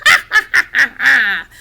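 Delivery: high, loud staccato cackle; wild witch cackle. A narrator's reading. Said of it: "then she cackled wildly".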